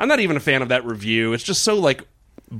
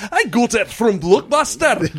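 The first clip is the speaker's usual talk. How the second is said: a higher, slower attempt at an Australian accent; 9 semitones higher, 3 dB louder, slower than usual. Reading an Australian woman's review in a mock Australian accent. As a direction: try an Australian accent and half fail at it. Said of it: "I can't do an Australian".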